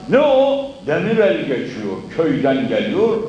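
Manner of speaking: ordinary talk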